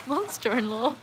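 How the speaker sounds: high crying laughter; laughing. Real, not acted. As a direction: crack up laughing at the misread. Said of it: "Totally read that wrong".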